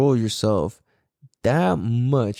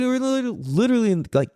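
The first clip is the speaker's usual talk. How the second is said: ordinary delivery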